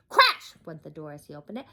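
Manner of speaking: ordinary delivery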